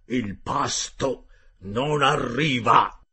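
slow, loud rough sailor voice; a hearty sailor, loud and slow; gruff harpooner Ned. Acted. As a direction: play the blunt Canadian harpooner, drawn out. Ned Land's voice in dialogue.

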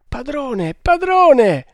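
high cry to the master, rising to a shout; frightened servant calling out; alarmed stammer. Acted. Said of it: "balbettò il Maharatto".

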